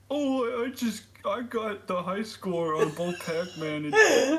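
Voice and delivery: high, loud, dim-witted character voice; Otis as moron. Acted. Otis tells Lori his high score in a high, moronic voice. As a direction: stammer it out high, like a goofy dope. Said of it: "making Otis sound like a fucking moron".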